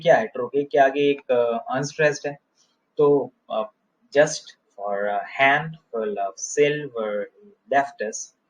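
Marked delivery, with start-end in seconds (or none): 4.2-8.4 slow rhythmic reading, just and handful stressed; dactylic scansion. Shown as a demonstration. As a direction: beat out the dactyls slowly, just, handful, silver, left. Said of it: "यहाँ ये tetrameter है". (just और handful पर ज़ोर देकर धीमी लय)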